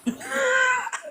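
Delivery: high-pitched burst of laughter; high squeal laugh. Real, not acted. High laugh after joke.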